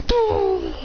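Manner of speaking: high, drawn-out "boom" effect; imitated explosion. Imitating the blast in the fight.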